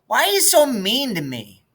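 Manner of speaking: put-on a small girl peeking out of bed; little sister's voice. Little sister Maybelle wakes and asks.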